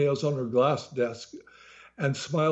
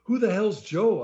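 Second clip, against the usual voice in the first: indignant loud question; 6 semitones higher, 4 dB louder than usual. A narrator's reading. Narrator annoyed at the desk clerk, loud. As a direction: demand it loudly. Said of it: "in a very loud voice".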